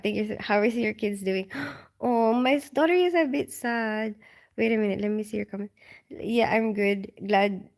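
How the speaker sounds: ordinary delivery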